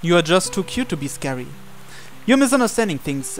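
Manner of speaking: normal talking voice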